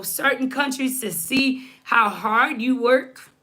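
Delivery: usual speaking voice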